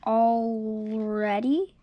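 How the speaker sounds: usual speaking voice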